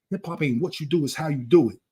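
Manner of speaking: normal talking voice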